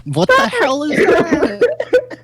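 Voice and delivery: high, slow, jeering schoolkid; mocking kid voice. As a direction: squeal it like a rude kid. S0 voices kids mocking lunch.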